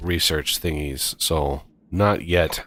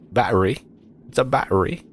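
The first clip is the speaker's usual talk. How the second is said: playful hammed-up British accent on battery, a touch higher; cheesy British accent; 5 semitones higher, much faster than usual. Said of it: "I have to say battery in a British cheesy accent".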